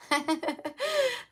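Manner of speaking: a squeaky high giggle. Real, not acted. Reader giggling at her own card reading, high squeaky laugh. Giggles after saying hot sex.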